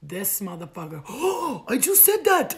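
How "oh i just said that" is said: high, loud gasping amazement; mock-amazed shout after a big gasp; astonished gasp. Suitor hears his words in a song, astonished.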